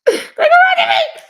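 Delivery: high, slow, laughing wail; cracking up over her own complaint; laughing squeal. Real, not acted.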